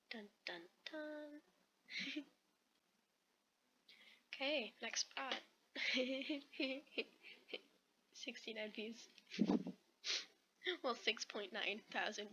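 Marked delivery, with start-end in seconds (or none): 0.1-1.4 soft sung "dun, dun, dun", last one higher; playful dramatic sting, kept quiet; mock suspense sting. Reader ends the chapter on a cliffhanger, mock-ominous sting. 5.7-7.6 giggling run of laughter; real giggle. Real, not acted. Reader breaks off between chapters, giggling.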